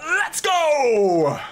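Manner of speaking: high, loud cheer. Real, not acted. Streamer cheering a viewer's super chat. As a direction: punch it out like a terrace chant.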